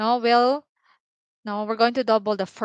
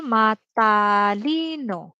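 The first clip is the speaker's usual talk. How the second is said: "matalino" drawn out, syllable by syllable; "matalino" stretched out, every syllable clear; slow model word; 3 dB louder, much slower than usual. Shown as a demonstration. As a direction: say "matalino" slowly for learners. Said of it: "Matalino means smart".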